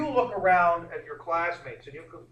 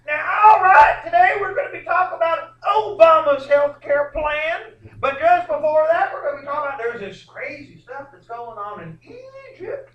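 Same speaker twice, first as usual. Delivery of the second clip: much higher, much louder hammed-up anchor voice; mock newscaster; 13 semitones higher, 15 dB louder than usual. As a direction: ham up a TV news anchor. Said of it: "do you ever hear any of the newscasters saying".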